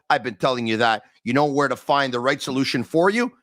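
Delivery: ordinary talk, nothing marked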